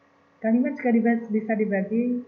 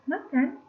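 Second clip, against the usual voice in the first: makan said as a question, pitched much higher with a rising tune; asking tone; 10 semitones higher than usual. Shown as a demonstration. Teacher showing students a one-word question, bright rising ask. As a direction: lift makan up high like asking whether someone is eating. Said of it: "Atau mungkin juga bisa satu kata saja".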